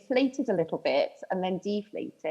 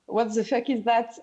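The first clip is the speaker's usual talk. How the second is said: English in a put-on French accent; French-Welsh accent. Shown as a demonstration. Guest demonstrates her school accent on request, French-Welsh accent. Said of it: "were you speaking French with a Welsh lilt?".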